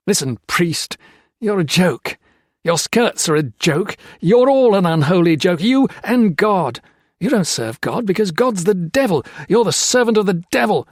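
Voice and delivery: loud, high contemptuous outburst; parting rage at the priest; angry insults. A narrator's reading. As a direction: spit scorn at the priest.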